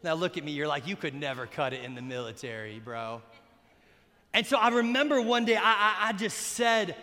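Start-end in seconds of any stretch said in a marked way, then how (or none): 0.9-3.2 low, soft, quick put-down; mock a skeptic, dropping the voice low; doubting listener.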